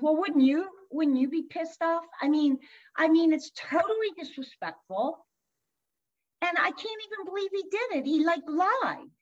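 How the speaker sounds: emphatic venting at being wronged; retold raw anger. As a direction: imitate an incredulous, offended client. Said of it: "we're really angry and that's starting".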